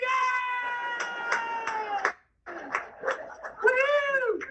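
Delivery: high, shouted crowd cheer; mock cheering. Imitating the meeting cheering him, shouted cheer. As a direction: whoop like a whole room celebrating.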